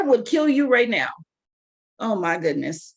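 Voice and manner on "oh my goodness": usual speaking voice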